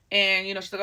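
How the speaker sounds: ordinary delivery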